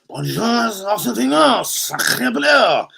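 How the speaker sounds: high, drawn-out cartoon French; mock French accent. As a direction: ham it up like a stage Frenchman. Mock French greeting to Arsene.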